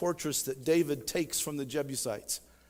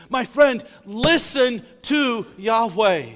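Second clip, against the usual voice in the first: loud exhortation; 4 semitones higher, 7 dB louder, slower than usual. Real, not acted. Preacher exhorting congregation.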